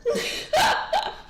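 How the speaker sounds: sudden high-pitched laugh; high squealing laugh. Real, not acted. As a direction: let out a squeaky giggle. Laughing at own plea.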